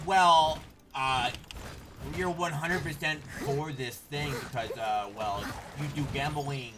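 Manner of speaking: sneering parody of a know-it-all; mocking H3's attitude. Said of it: "he has this, like, attitude of".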